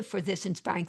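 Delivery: usual speaking voice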